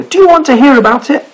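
high, eager little sailor voice; high rabbit voice. Acted. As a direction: squeak it out like a small rabbit. Sailor voice continues.

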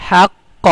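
হাক্ক with the ক held, then released; doubled consonant. Shown as a demonstration. Teacher showing the tashdid rule, হাক্ক with doubled ক. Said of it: "অক্ষর দুইবার উচ্চারণ করে পড়তে হয়".

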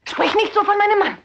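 furious defence of her husband; angry outburst. Acted. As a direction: snap back in outrage. Woman defends her husband angrily.

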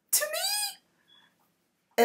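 breathless high whisper; awed whisper. Retold or imitated. Telling of God coming to her, in an awed whisper.

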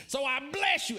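loud, high-pitched blessing; forceful mock blessing. Preacher re-enacting blessing the guy who cussed him, loud high retort. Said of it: "That's how I said it".

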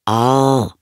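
loud, drawn-out howl from a cupboard; muffled cry. A narrator's reading. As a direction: cry out as a little vampire shut away. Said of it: "schrie eine dumpfe Stimme". (dumpfer Schrei aus dem Schrank)

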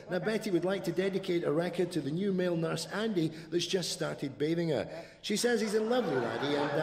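bright, upbeat hospital-radio presenter; cheesy local radio DJ voice; radio DJ patter. Acted. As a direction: announce it to the retirement home listeners.

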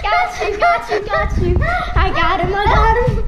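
loud, high triumphant gloat; excited gloating. Real, not acted. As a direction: crow over the win. Prank finally worked.

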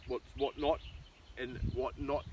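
ordinary talk, nothing marked

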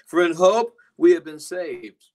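loud, slow scripture proclamation; preaching loud. Acted. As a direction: proclaim it to the congregation.

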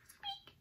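tiny, very high squeak; high, faint little squeak; mouse squeak. A narrator's reading.

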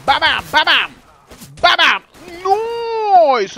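loud, high ba-bam shout; excited shout. Real, not acted. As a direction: shout the kills out. Caster shouting at kills.

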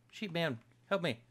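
normal talking voice

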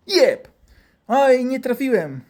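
high, loud strike then drawn-out oops; hammed-up slow, high regret; mock dismay. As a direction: exclaim at a botched hit. Doctor strikes and misses.

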